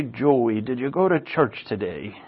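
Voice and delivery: a hint of Mom's soft questioning; mom imitation. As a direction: softly ask it like a checking-up mom. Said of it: "That's how my mom talks".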